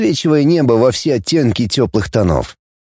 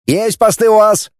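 crisp reply; 9 semitones higher than usual. Acted. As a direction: snap back a firm acknowledgment. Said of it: "отозвались операторы". (чёткий ответ операторов)

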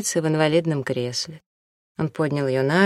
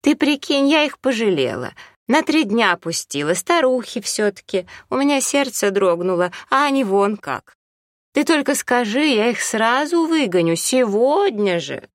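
wailing complaint; 7 semitones higher than usual. Acted. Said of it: "заголосила в трубку".

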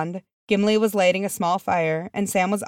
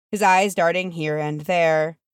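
usual speaking voice

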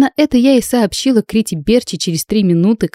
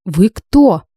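mildly startled, louder question; startled gasp; 2 semitones higher, 5 dB louder than usual. A narrator's reading. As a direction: blurt it as if taken aback. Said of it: "ахнула я с испуга". (испуганный возглас)

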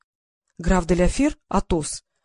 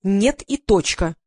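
slow, drawn-out final no; haughty, emphatic refusal; stubborn refusal; 4 dB louder, much slower than usual. A narrator's reading. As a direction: dig in and stretch the refusal. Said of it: "Вадик вздёрнул подбородок".

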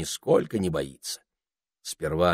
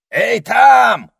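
loud high shout; yelling; 13 dB louder than usual. A narrator's reading. Parrot hailing the ermine sentry, loud yell. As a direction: holler it across. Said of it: "заорал попугай".

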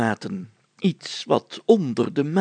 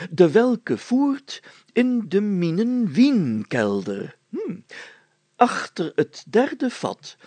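put-on slow, higher, ceremonious recital of an old deed; solemn archaic reading; 6 semitones higher, slower than usual. Mayor reading an old parchment aloud to his friends, slow and solemn. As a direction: read it like a proclamation from centuries past.

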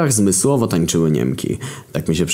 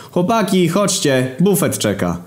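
high cheery call; 11 semitones higher, slower than usual. Acted. Edward calls them out.

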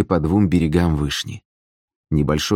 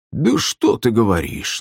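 slow, higher mock amazement; feigned surprise; 5 semitones higher, slower than usual. A narrator's reading. Gusli player answering the sister, exaggerated feigned surprise. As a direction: draw it out, pretending to be astonished. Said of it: "наигранно удивился Вадзим".

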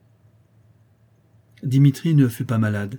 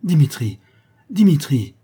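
loud, high, alarmed call; trembling cry; 5 semitones higher, 7 dB louder than usual. Acted. Governess hushing the boy within mother's hearing, alarmed cry. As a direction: cry the name out in alarm, falling. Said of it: "s'écria mademoiselle Bauchet tremblante".

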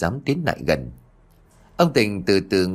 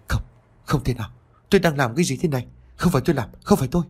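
higher-pitched horrified denial, fully voiced; dazed muttering; 5 semitones higher, much faster than usual. A narrator's reading. Father staring at his bloodied hands, horrified muttering to himself. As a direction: protest to yourself in mild panic. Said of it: "lẩm bẩm".

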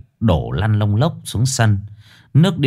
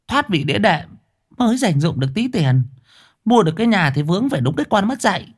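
high chattering wife; fussy old wife; 8 semitones higher than usual. Acted. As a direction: talk it over fast and high. Wife character voice.

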